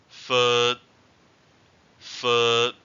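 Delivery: "Foot" held long and loud on a level tone; long vowel. Shown as a demonstration. Teacher giving a long-vowel example, drawn-out "Foot". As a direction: stretch the vowel of "Foot", don't release the end. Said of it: "examples with long vowels".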